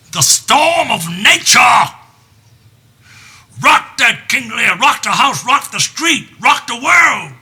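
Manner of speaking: high, loud, slow storm telling; preacher-like storm narration; thundering storyteller. Acted. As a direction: build the storm like a sermon.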